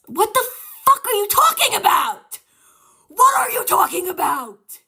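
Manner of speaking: high, crying shout of disbelief; shocked shout. Real, not acted. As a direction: yell it in confused outrage. Shocked by chat's story.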